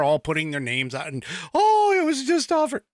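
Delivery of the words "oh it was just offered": mock excitement. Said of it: "mocking the online left".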